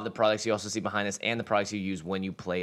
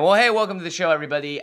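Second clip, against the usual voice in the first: excited welcome; 7 semitones higher, 7 dB louder, faster than usual. Real, not acted. Host's opening greeting.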